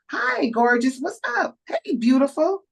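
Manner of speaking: high, lilting acted hello; enthusiastic greeting. Showing how brothers and sisters should embrace each other, enthusiastic greeting. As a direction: greet them as royalty, pitch leaping up.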